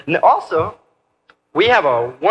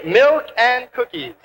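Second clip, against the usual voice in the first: model call-and-response; 6 semitones higher, slower than usual. Shown as a demonstration. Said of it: "Everybody say".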